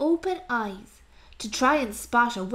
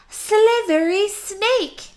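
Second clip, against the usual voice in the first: higher, louder, slow reveal; dramatic announcement; 7 semitones higher, 5 dB louder, slower than usual. Acted. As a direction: announce it like a showman.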